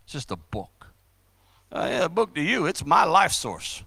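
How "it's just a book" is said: low, hushed dismissiveness; mock disdain, much softer and lower. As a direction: toss it off quietly as worthless. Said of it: "with disdain".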